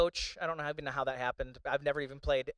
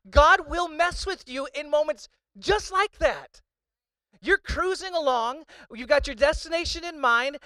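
loud, higher impassioned sermon; preaching at full force; 8 semitones higher, 9 dB louder than usual. Acted. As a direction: thunder it out to the congregation.

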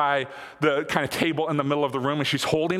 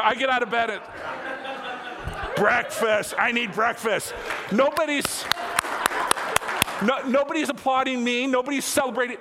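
higher, hammed-up whiny complaint; mock self-pity; 6 semitones higher than usual. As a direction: pretend to sulk that nobody claps. Mock complaint about being ignored.

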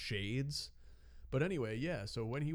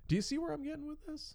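squeaky fast question; high teasing squeak; faster than usual. Real, not acted.

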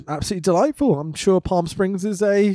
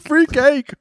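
gleeful free cake; 9 semitones higher than usual. Retold or imitated.

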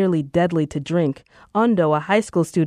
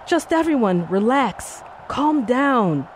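mic announcer; 6 semitones higher, slower than usual. Acted. Voice on the mic at memorial.

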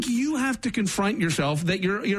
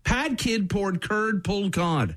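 slow and careful; careful slow take; slow retry; 2 semitones higher, slower than usual. Shown as a demonstration. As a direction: step through the P and C sounds. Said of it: "between the P and the hard C".

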